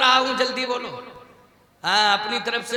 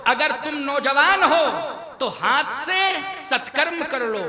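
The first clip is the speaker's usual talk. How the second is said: ordinary delivery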